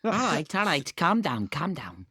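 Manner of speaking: mock Scouse catchphrase, falling away at the end; Scouse accent. Shown as a demonstration. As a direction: put on a jokey Scouse accent. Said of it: "Anyone can do a Scouse accent".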